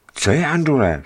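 high, urgent question; alarmed concern. Acted. Driver checks on Andule after the crash, alarmed. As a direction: call anxiously to check on her.

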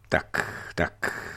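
soft, low-key grumble; grumbling. A narrator's reading. Pirate chief watches the battle, grumbling to himself. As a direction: mumble it to yourself. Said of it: "bručel a díval se".

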